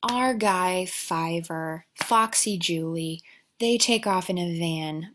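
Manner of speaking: ordinary delivery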